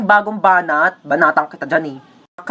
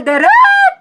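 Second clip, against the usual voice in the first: loud call; 5 dB louder than usual. Acted. Calling out to director.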